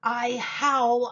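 plain reading voice

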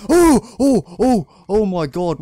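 loud, high frightened cries, then urging himself on; panicked yelling. Real, not acted. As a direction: shriek and push yourself to keep running. Monster chasing in horror game.

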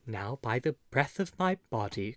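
ordinary delivery

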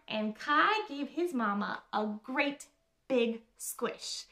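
usual speaking voice

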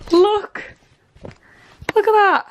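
very high, loud thrilled calls; excited shout. Real, not acted. Showing viewers the tidy wardrobe, loud high excitement. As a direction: shout it out, bursting with pride.

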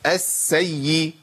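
السيء very slow, s then y drawn out; held sin and ya. Shown as a demonstration. Teacher isolating the doubled letters, slow stretched السيء. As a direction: linger on both doublings in السيء. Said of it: "لازم أقول".

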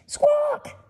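very high parrot screech; parrot squawk. A narrator's reading. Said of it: "Parrot's loud squawks".

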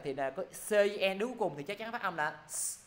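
ordinary delivery

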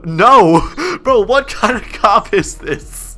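high, drawn-out disbelief; incredulous protest. Pretend. Inmate refused a phone call by the cop, incredulous. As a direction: protest with an exasperated laugh.

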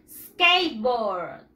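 SKATEBOARD as two slow English syllables, "skeit-bord", falling low; English pronunciation model. Shown as a demonstration. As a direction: model SKATEBOARD for repeating, drawn out and dropping in pitch. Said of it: "Again, practice".

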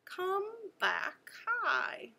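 lifted high on "high". Shown as a demonstration. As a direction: pitch jumps up high.

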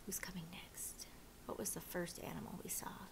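low, very soft whispered questions; hushed, confiding quiz; whispered aside. Real, not acted. Quiet aside to the listeners.

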